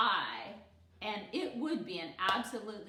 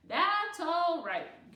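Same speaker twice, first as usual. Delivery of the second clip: gracious reassurance; 8 semitones higher, 10 dB louder, much slower than usual. A narrator's reading. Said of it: "Gooney Bird replied".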